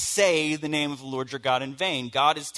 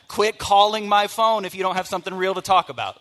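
louder, higher, fed-up caller voice; mock annoyed God; 6 semitones higher, 9 dB louder than usual. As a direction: play God as a petty annoyed friend. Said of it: "It's like".

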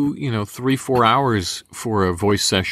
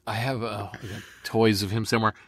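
usual speaking voice

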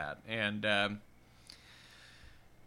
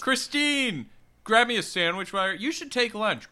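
high, hurried mock ordering-about; mock pushy office order; bossy male coworker; 10 semitones higher, 3 dB louder than usual. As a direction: call out to an underling, hammed up. Voicing bossy coworker sending her out.

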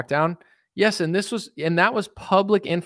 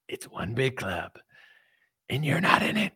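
lower, softer Carlin-style deadpan; Carlin line, low; 3 semitones lower, 7 dB quieter than usual. Retold or imitated. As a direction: drop the voice and deliver it dry like Carlin. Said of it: "And he's just like".

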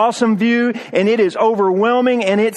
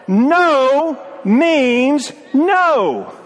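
higher, slow and firm; defiant; 5 semitones higher, much slower than usual. Shown as a demonstration. Preacher telling the church to rebuke the devil: defiant. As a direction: say it right at the devil. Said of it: "tell the devil".

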